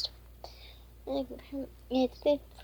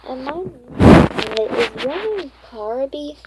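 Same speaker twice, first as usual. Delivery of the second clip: dragon voice; 3 semitones higher, 12 dB louder than usual. Acted. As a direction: voice a big friendly dragon introducing himself, slowly. Narrator voices the dragon Carby.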